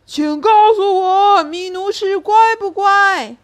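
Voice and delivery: loud, high, slow lion shout; lion shouts up to the acrobats, loud and slow. Acted. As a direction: yell up to the acrobats in the rafters. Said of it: "狮子只好大声喊叫".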